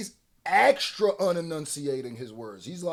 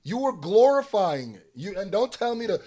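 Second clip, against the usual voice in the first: normal talking voice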